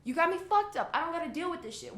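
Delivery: high, livid pushback; acted-out livid retort. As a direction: refuse angrily, pitch riding high. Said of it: "would have been so fucking livid".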